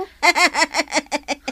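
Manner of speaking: a loud, open burst of laughter; bright chuckle that bubbles over; hearty laugh. Acted. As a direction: laugh out loud at your own joke. Laughing at own joke.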